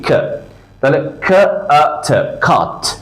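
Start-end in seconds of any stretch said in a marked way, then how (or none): 1.2-2.9 খাত broken into খ, আ, ত, then blended; sounding out. Shown as a demonstration. Teacher shows learners the sounds in খাত, slowly sounding out. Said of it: "কিন্তু sound হলো এখানে কি".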